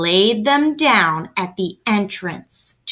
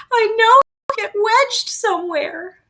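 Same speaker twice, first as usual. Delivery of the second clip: tearful mother; 13 semitones higher than usual. A narrator's reading. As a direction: lament over her lost son. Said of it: "Missus Little began to cry".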